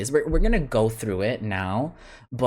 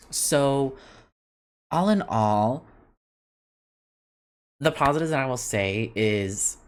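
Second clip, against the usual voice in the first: ordinary talk, nothing marked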